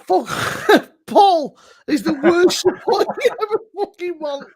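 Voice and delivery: real laugh breaking the line. Real, not acted. Teasing Paul about his support, laughing.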